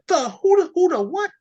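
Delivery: very high, loud squeaky confusion; high-pitched flustered "who the what"; shocked stammer. Retold or imitated. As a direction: sputter in disbelief. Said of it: "Alina was like".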